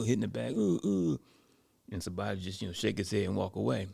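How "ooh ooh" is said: loud, high "ooh" on each hit; imitated punching grunts. Guy goes back to the bag, imitated loud grunts.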